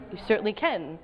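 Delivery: ordinary delivery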